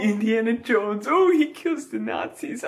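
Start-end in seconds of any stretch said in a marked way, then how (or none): 1.1-2.6 high sneering mimicry of the films; mock the American movies in a much higher voice; mocking quote. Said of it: "Oh, what garbage".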